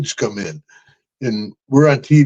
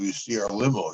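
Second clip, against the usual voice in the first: normal talking voice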